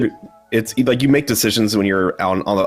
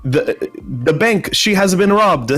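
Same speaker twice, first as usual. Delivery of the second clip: slow, higher, hammed-up fake Italian accent; cheesy Italian accent; 10 semitones higher, slower than usual. Quoting her own old stage line, in a cheesy Italian accent. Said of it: "one of my lines was".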